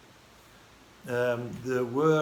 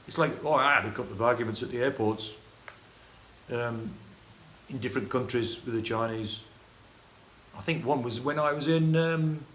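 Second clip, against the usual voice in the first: ordinary talk, nothing marked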